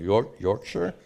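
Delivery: attempting a British Yorkshire, dropping the r; tentative British-accent Yorkshire; questioning British take on Yorkshire; British try at Yorkshire. Shown as a demonstration. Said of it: "How would, uh, some British pronounce it?".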